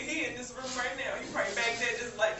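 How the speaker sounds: normal talking voice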